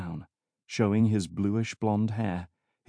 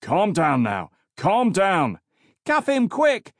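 raised, urgent shouts climbing higher; cries of officers; 9 semitones higher, 4 dB louder, slower than usual. A narrator's reading. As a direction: call out over a struggle. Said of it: "the cries of other officers".

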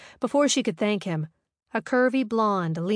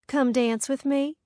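higher, coaxing seductive invite; sultry; 7 semitones higher than usual. Acted. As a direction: lure him onto the floor. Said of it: "The blonde's sultry, seductive voice".

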